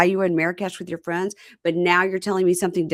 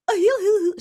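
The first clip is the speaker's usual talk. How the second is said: mock sobbing; 4 dB louder, faster than usual. Imitating her crying.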